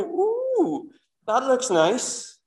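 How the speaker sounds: high, drawn-out delighted "ooh" of a greedy fish; tempted fish. Acted. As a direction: coo over the bait as the hooked fish. Fish tempted by bait, voiced.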